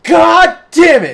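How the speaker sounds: very high, loud, slow enraged shout; Frank's slow, screaming rage; furious Frank yell. Retold or imitated. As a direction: explode like Frank throwing a fit. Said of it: "he's just all like".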